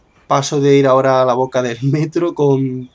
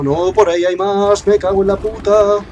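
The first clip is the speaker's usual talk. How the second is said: alarmed shout; 6 semitones higher, 4 dB louder than usual. Real, not acted. More monsters appear.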